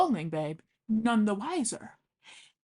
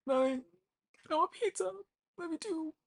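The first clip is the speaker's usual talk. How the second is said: mock whiny child; 9 semitones higher, 6 dB quieter than usual.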